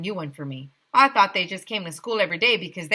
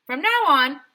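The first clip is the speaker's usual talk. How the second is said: pompous mayor; 8 semitones higher, 7 dB louder than usual. A narrator's reading. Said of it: "the mayor continued".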